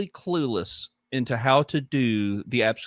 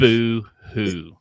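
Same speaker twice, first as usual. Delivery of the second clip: mocking; 6 dB louder than usual. Real, not acted. Mocking the excuses.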